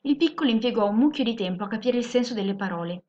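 usual speaking voice

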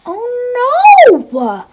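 high, loud oh no; mock alarm. Reacting to the tantrum, high mock alarm. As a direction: gasp it out for the kids.

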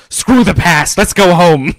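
loud, high battle cry; rallying shout. Retold or imitated. Professor abandons caution to go home, hammed-up rallying shout. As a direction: yell it like a gung-ho cartoon leader.

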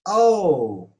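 loud, high astonished cry; surprise. Shown as a demonstration. Teacher demonstrates astonishment, surprised. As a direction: let out a startled exclamation. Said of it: "Yani şaşkınlık".

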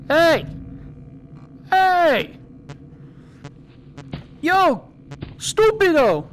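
frustrated hollering; shouting at them. Real, not acted. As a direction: yell across the room at the figures. Yelling at game characters.

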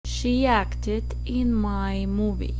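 very slow, each word spaced for repeating; slow model sentence. Shown as a demonstration. Teacher dictating slowly for students to repeat. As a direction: read it slowly so students can echo every word. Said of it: "Repitan después de mí".

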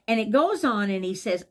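ordinary talk

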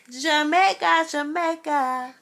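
high, drawn-out put-on Jamaican accent. Showing off an island accent on request, playful Jamaican accent. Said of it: "Do your accent again".

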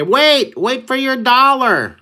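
high, loud, slow call; calling after. Retold or imitated. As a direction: shout after the pizza guy. Guard calls after pizza guy.

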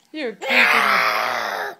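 loud slow hammed-up cry; mock wail. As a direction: wail as if overpowered. Mother mock crying.

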